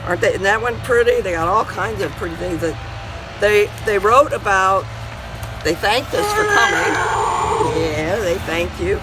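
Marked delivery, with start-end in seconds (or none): none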